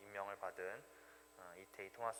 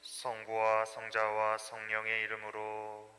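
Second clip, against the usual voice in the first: liturgical intoning; 10 dB louder than usual. Real, not acted. Priest opens the mass.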